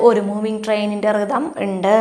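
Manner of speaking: normal talking voice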